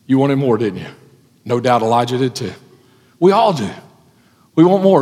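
ordinary talk, nothing marked

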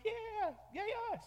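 high excited cheer; mock cheer, high-pitched. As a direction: whoop it up for the team's win. Cheering for another team's win.

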